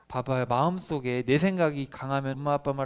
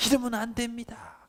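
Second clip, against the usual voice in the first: much higher urgent appeal; earnest pleading; 8 semitones higher than usual. Acted. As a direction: beg the crowd to trust God. Joshua and Caleb pleading.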